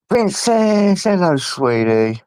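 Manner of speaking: cooing high at the dog Princess; doting pet voice. Real, not acted. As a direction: coo it up high like greeting a puppy.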